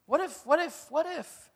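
lightly acted anxious inner voice; fearful what-ifs. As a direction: stammer the worried question. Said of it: "The voice of fear says".

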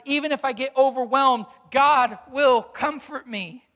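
preacher declaring God's promises boldly; confident voice of God. Acted. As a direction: proclaim it with mounting conviction. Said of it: "The voice of God says".

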